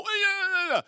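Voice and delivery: high, sing-song dismissive yeah; passing an offender with a mock-sweet yeah; fake-polite brush-off. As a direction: brush them off with a forced cheerful yeah. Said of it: "when you walk by someone and you go".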